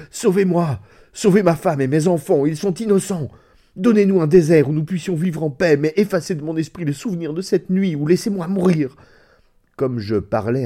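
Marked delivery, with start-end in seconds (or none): 0.1-9.0 beaucoup plus haut et plus fort, implorant; implore-le à genoux, affolé; supplication désespérée. Acted. Il supplie le diable, prosterné. (supplication affolée, à genoux)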